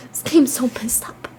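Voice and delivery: whispered under the breath; whispered aside. Real, not acted. Aside about the game.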